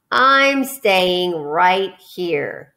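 very slow, drawn-out grumble; slow, sulky rat refusing to go; grumbling rat. Acted. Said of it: "grumbled the rat".

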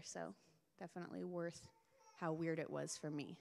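normal talking voice

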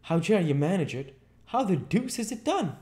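very high, loud, astonished questioning; baffled Marvel. A narrator's reading. Marvel asks how invisibility is done, high and baffled. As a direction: demand it in high-pitched bafflement.

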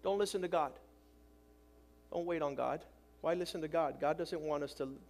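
low, hushed, coaxing devil; tempting Satan voice. Acted. Satan's voice coaxing the lonely away from God, low and hushed. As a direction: whisper doubt into the listener's ear. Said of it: "that's when Satan's voice says".

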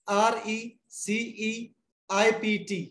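slow dictation of letters; spelling receipt aloud for students. Shown as a demonstration. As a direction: spell आर ई सी ई आई पी टी slowly. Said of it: "fifth word यहाँ लिख रहा हूँ".

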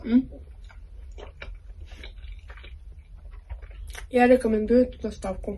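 chewing while speaking; talking through food; mouth full. Real, not acted. Seen 3: Bites roll at -1.0, cheeks full, chewing while talking.

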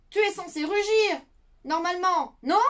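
a hint of a bossy child; puzzled little knight. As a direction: poke at the dragon, half scolding. Small knight questions the dragon.

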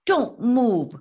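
loud, high scolding order; scolding cry. A narrator's reading. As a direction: cry out a command to the reindeer. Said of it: "Tika scolded the reindeer".

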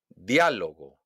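"Diálogo" punched on DIÁ; exaggerated stress on Á. Shown as a demonstration. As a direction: hit DIÁ, let "Diálogo" fall away. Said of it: "exagerar la pronunciación". ("Diálogo" con golpe de voz en DIÁ)

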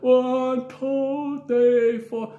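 high, loud halting count; high counting boy. Retold or imitated. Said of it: "So he's like".

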